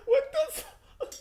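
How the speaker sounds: squeaky laughing disbelief; high wheezing laugh. Real, not acted. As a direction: barely speak laughing.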